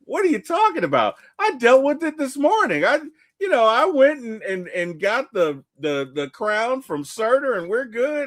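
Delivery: high, bragging mock voice; cocky Thor. Thor boasting to his father Odin, high playful mock voice. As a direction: brag it way up, breezy and proud. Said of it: "He's like".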